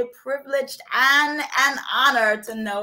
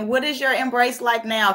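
ordinary talk

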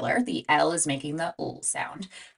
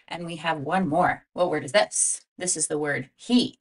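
ordinary talk

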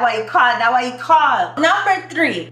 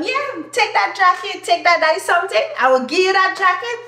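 pitched-up offering voice; high, generous offer; 7 semitones higher than usual. Acted. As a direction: act out handing it over, voice high. Acts out giving away a jacket.

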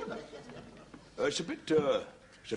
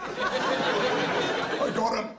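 gloating laugh; 11 semitones higher, 4 dB louder, much slower than usual. Acted. Gloating over trapping the troupe.

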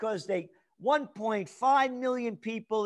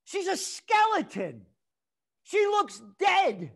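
scornful shout; 7 semitones higher, 4 dB louder than usual. Real, not acted. Ranting about a health official.

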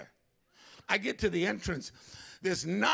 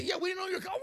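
high, laughing brush-off; dismissive driver, high and amused; mocking driver voice; 13 semitones higher, much faster than usual. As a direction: toss it off with a laugh, pitched way up.

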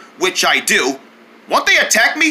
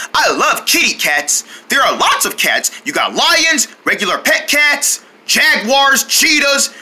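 ordinary talk

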